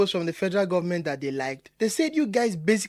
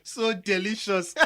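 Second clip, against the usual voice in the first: savoring it slowly, then a laugh; gloating laugh; 2 semitones higher, 4 dB quieter, slower than usual. Acted. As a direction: relish the scandal, then laugh out. Gloating over the expose online.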